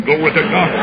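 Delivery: ordinary talk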